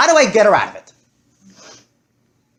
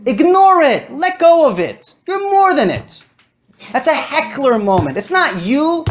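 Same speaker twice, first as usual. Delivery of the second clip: teacher urges students to ignore fears, emphatic; emphatic urging; 9 semitones higher than usual. Real, not acted. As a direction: drive the point home. Emphatic exhortation.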